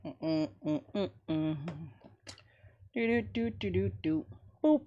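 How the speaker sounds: beeping robot noises. Pretend. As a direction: hum and boop like a robot. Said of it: "The voice sound like a robot".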